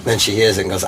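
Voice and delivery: ordinary talk, nothing marked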